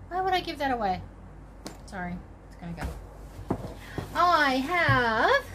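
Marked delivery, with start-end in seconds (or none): 1.8-3.7 whispered aside. Real, not acted. Sorting the box while talking to viewers, whispered aside. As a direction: mutter it under your breath.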